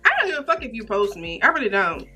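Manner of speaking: ordinary talk, nothing marked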